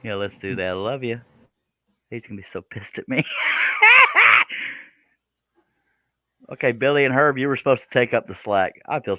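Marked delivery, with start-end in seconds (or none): 3.3-4.7 loud breathy laughing fit; burst of laughter. Real, not acted. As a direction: crack up out loud. Laughing after muting brother.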